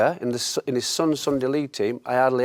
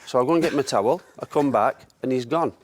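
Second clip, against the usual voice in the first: usual speaking voice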